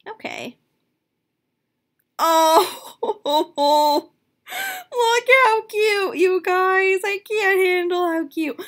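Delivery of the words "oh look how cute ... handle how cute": high, loud delighted squeal; overwhelmed by cuteness. Real, not acted. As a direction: squeal like you can't take it. Overwhelmed by doll outfit.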